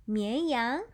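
ordinary talk

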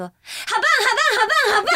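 high, loud, laughing "好棒" chant; loud sleep-talk imitation. 学室友梦里大喊好棒. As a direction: imitate a roommate shouting in his sleep. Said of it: "我很大声哦，是像刚刚那样子哦".

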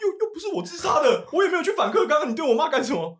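high, laughing protest against the accusation; indignant protest. Retold or imitated. Son protesting to his angry father, high and laughing. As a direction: throw it back at him, half laughing. Retorting to his raging dad.

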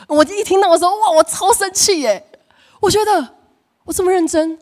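high, loud, stretched outrage; recalling anger, pitched high and loud; indignant outburst. Retold or imitated. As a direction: vent the fury of being wronged.

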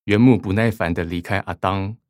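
ordinary talk, nothing marked

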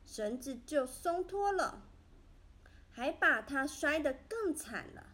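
plain reading voice

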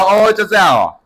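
a loud, high "oh, that's it?"; flat, unimpressed. Retold or imitated. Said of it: "我就觉得很无聊".

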